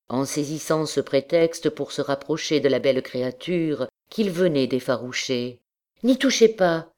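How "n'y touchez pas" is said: higher, sharper-pitched protest; lively, high-pitched objection; quick retort. A narrator's reading. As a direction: jump in quickly, voice lifted. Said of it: "répondit-elle vivement".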